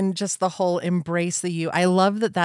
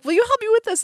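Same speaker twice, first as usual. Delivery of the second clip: much higher, chirpy ask; bubbly friend asking; 5 dB louder, much faster than usual. Retold or imitated. Imitating the excited friend asking for help, high and chirpy. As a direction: ask the favor in a bright high voice. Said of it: "And then she's like".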